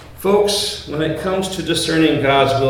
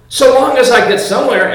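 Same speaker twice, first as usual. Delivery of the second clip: Alice's voice; 9 semitones higher, 7 dB louder than usual. Acted.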